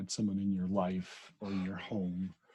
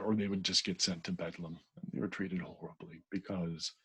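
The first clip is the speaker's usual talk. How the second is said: normal talking voice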